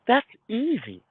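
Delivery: high scornful brush-off; scoffing dismissal. Real, not acted. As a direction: wave it off as nothing. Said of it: "But can you kill that demon".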